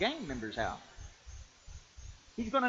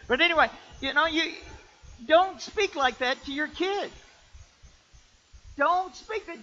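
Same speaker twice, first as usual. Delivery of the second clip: full-force preaching; 11 semitones higher, 9 dB louder than usual. Acted. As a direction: preach it as a firm warning.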